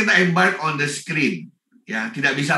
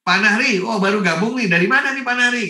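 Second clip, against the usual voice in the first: higher and louder, hailing a newcomer; cheerful greeting; 6 semitones higher, 3 dB louder than usual. Shown as a demonstration. To a viewer who just joined the live class, a cheerful, raised greeting. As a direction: call out a friendly welcome by name. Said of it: "saya ngomong sama mereka".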